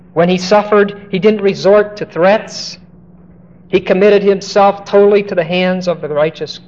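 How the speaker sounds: fervent preaching. Acted. Preaching rising in fervour.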